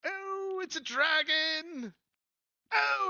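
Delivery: high squealing goblin panic; squeaky small goblin alarm; Jingle Jangle, frightened. Acted. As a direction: shriek in hammed-up terror and draw it out. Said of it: "He's like, he's like".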